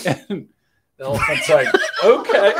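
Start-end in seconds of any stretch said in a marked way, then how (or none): 2.0-2.5 high squeaky okay through a laugh; laughing elephant voice. Retold or imitated.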